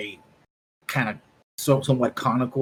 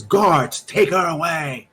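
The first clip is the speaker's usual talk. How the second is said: higher, louder order to the guards; commanding shout; 6 semitones higher, 4 dB louder, slower than usual. Acted. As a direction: shout it like a furious admiral. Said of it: "and he eventually says".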